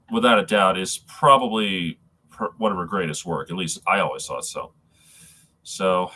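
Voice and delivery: ordinary talk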